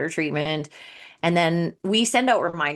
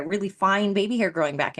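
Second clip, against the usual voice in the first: usual speaking voice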